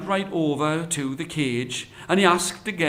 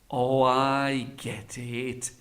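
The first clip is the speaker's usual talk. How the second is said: slow, drawn-out realisation; slow dawning; 2 semitones lower, much slower than usual. Acted. Beggar pretending to understand the tiger, slowly. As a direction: stretch it out as if it just clicked.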